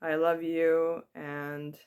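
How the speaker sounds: ordinary delivery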